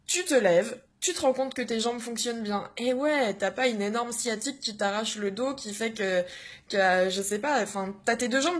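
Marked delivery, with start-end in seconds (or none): none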